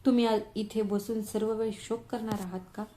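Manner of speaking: plain reading voice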